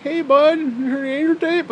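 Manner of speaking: high, goofy voice for the friend; imitating his friend. Friend asking about the Angel tape, goofy high voice. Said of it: "He's like".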